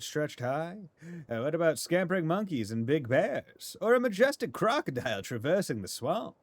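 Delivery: high, loud, swooping promo read; big sing-song barker delivery, punching key words; hammy advert announcer. Acted. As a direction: sell it like an excited showman's ad. Reads out a newspaper advert.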